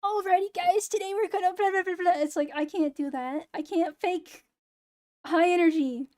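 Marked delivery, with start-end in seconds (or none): 0.0-2.2 higher, mock-peppy YouTuber intro; energetic YouTuber. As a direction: chirp it like a hyped channel opener, ironically. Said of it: "the fucking YouTuber, eh, like, energetic".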